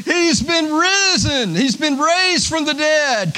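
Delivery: triumphant shout of the empty tomb; angel's proclamation. Acted. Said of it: "The angel said".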